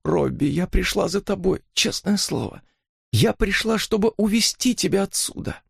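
high, stifled pleading voice; choked, tearful. A narrator's reading. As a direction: plead as if holding back sobs. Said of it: "Она придушенно всхлипнула". (придушенно, сквозь слёзы, высоким голосом)

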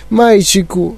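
put-on a mild cry to a child; exclaiming. A narrator's reading. Mother calling her son, mildly exclaiming. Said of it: "вигукнула пані Т В".

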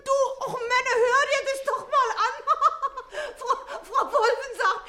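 scoffing laugh while repeating her words; incredulous laughing retort; laughing mockery. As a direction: laugh it off, high and amused. Mocking laugh at neighbour's claim.

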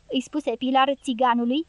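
usual speaking voice

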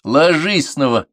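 slow, high, loud command; commanding order. A narrator's reading. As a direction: order a pupil back down. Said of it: "приказал он Егорову".

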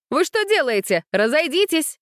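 high, alarmed order; urgent scolding call. A narrator's reading. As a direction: call to riders to break it up, high-pitched. Coach breaking up a horse fight.

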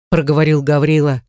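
plain reading voice

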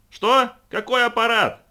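high, loud yell over the noise. A narrator's reading. Quota yelling back to Brad over the noise, loud yell. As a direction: bellow the question over the din. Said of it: "вопил Квота".

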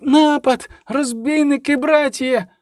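put-on very high, sustained cry of a young monk; high-pitched squeal of warning; shrieked alarm. A narrator's reading. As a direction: cry out to the brothers in panic. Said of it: "заверещав юний ченчик".

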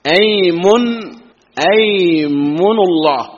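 أيمن and أيمن الله drawn out very slowly; slow demo pronunciation. Shown as a demonstration. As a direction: stretch أيمن الله for the students. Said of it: "أيمن هذا قسم". (نطق أيمن الله ببطء للتوضيح)

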